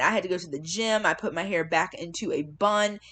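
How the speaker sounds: ordinary delivery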